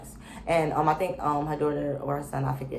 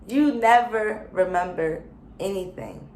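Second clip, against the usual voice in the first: scolding; 4 semitones higher, 6 dB louder, slower than usual. Real, not acted. Scolding Sheree, repeated louder.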